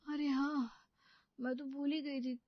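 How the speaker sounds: ordinary talk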